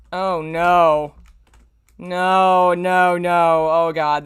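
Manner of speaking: loud, groaning no no no; loud dismay. Real, not acted. Reader dreading the next chapter, loud groaning dismay. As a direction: cry out in dread at the page.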